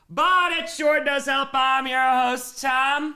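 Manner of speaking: booming podcast host intro; host intro hype. Acted. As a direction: announce yourself like a showman. Show intro, host announcing.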